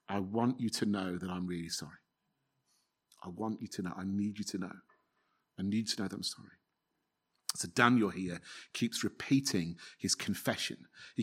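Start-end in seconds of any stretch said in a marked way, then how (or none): 0.1-6.6 hushed plea. Pretend. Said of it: "'Cause you're just".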